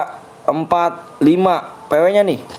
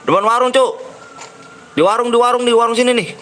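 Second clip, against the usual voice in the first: alarmed; 6 semitones higher than usual. Real, not acted. Gamer panics spotting enemy.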